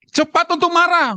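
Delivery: high-pitched, punched-out exclamation; emphatic mock-wrong completion. Preacher teasing the congregation with a twisted verse, high mock exclamation. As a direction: call it out high and forceful.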